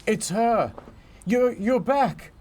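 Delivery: high, thrilled gasping reunion; excited. Pretend. Spotting long lost mermaid.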